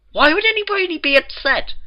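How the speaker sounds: lightly voiced Twilight pleading reason. A narrator's reading.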